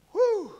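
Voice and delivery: high, loud exhaled whoop; exaggerated whew of relief. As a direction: let out a big acted whew. Bull's acted relief after racing count.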